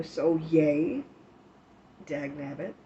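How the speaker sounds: usual speaking voice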